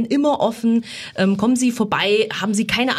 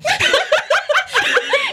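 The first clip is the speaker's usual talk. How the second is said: lautes Lachen. Real, not acted. Amused laugh after a compliment.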